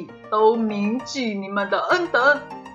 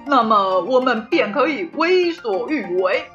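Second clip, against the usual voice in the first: boastful king; 2 semitones higher, 5 dB louder than usual. Acted. King declares conquest to subjects.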